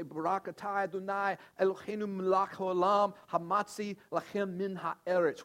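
"Barak atah Adonai" intoned in careful Hebrew, with the last words slowed; Hebrew blessing recited. Retold or imitated. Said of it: "they would say".

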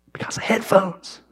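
soft unvoiced aside; hushed whisper. Real, not acted. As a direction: whisper the cause gravely.

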